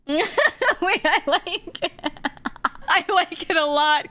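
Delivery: high-pitched, breaking into giggles; delighted approval through laughter; laughing. Real, not acted. Cracking up at joke name.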